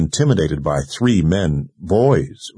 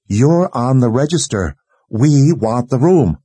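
higher-pitched, firm demand through a door; insistent demand; 5 semitones higher, 4 dB louder than usual. A narrator's reading. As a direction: press the point, meaning to have it. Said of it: "said she meant to have it".

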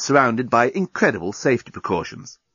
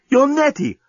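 much higher astonished cry; shocked recognition; 14 semitones higher, 3 dB louder than usual. A narrator's reading. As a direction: recognize her in disbelief. Dan recognizing Nettie.

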